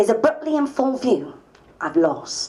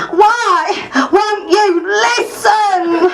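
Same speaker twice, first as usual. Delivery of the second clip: slow, high, tearful yell; loud wailing demand; crying shout; 9 semitones higher, 7 dB louder, much slower than usual. Retold or imitated. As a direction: scream it through tears. Angry constituent heckling at meeting.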